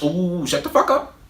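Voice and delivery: high, dismissive ooh then the brush-off; sassy dismissal. Retold or imitated. Cutting off the teacher mid-lecture, sassy and dismissive. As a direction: wave her off with sass.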